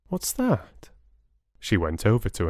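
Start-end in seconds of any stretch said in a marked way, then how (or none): 0.1-0.9 high, light question; curious surprise. Acted. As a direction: voice pops up in curiosity. Bella spots shop window.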